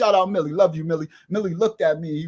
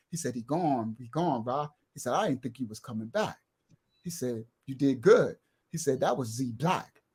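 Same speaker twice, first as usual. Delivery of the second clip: put-on lower, softer old man's voice; lightly voiced old neighbour calming the young man; 6 semitones lower, 4 dB quieter than usual. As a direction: soothe him, low and soft. Old man Coley calming him.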